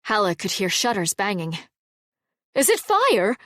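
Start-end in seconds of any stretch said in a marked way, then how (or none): 2.5-3.4 much higher, louder alarmed yell. Acted. Townsman shouting about a fire, yelled. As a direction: call out across the street in alarm. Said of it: "someone yelled".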